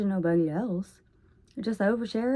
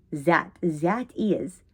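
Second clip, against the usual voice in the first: drawn-out Russian accent, TH turned to Z in "that"; very slow Russian-accented "that, that is"; 2 semitones lower, much slower than usual. Shown as a demonstration. Showing how the accent swaps TH, Russian accent. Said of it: "instead of the T H".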